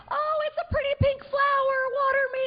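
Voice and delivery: put-on very high, sing-song girly voice; hammed-up sweet, high squeal; dainty flower. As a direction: coo it like a precious little flower. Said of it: "one of them is like".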